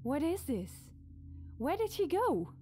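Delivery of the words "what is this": ordinary delivery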